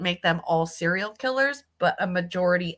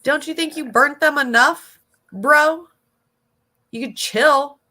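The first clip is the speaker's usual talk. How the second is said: loud scolding; 8 semitones higher, 7 dB louder than usual. Real, not acted. Scolding the video maker.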